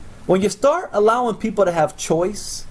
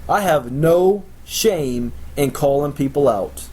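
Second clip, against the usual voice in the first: usual speaking voice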